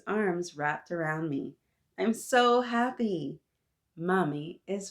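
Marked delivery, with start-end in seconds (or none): 2.0-3.4 high, slow, savoring happiness; delighted child, pitched up; overjoyed. A narrator's reading. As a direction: stretch it out with joy. Child rejoices at reunion.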